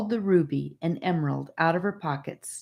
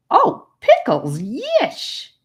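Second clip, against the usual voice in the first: loud, slow then squealing high; boy thrilled by sugar candy, loud and delighted; 11 semitones higher, 8 dB louder, much slower than usual. A narrator's reading. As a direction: savor it with a gleeful yelp.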